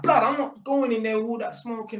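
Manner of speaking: high-pitched, heated retort; agitated, imitated comeback; blasting back. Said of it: "I just had to blast back at him".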